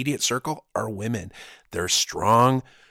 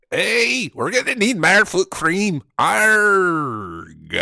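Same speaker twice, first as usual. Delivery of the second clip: mock pirate sailor; 5 semitones higher, much slower than usual. Jokes he was a sailor.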